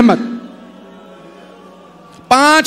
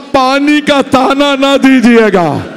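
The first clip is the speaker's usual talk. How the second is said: anguished plea. Acted. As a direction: plead with a breaking heart. Hur's remorseful plea. (हुर की ऊँची, दर्दभरी फ़रियाद)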